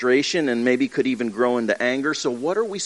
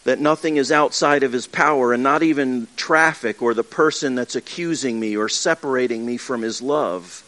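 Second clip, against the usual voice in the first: ordinary talk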